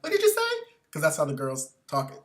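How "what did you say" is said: high, chirpy Starbucks barista. Retold or imitated. Mocking the Starbucks girls' chirpy voice. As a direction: squeak it like a perky barista. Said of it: "the girl in the counter is like".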